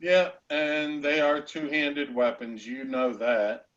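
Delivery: normal talking voice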